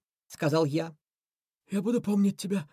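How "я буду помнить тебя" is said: anguished cry. Acted. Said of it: "с надрывом крикнул бобёр".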